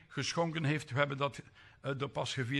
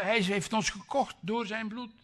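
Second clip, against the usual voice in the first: ordinary talk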